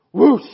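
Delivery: loud rushing fire sound effect; loud whoosh. Acted. Preacher voicing fire falling from heaven, loud whoosh.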